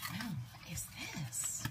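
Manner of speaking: soft, slow whisper of wonder; hushed whisper. Real, not acted. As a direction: breathe it out in awe. Opening gift, hushed whisper.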